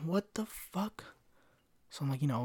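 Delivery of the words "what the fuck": slow, quiet, stunned "what the fuck"; drawn-out stunned disbelief; shocked disbelief. Retold or imitated. Finding lady left bathroom.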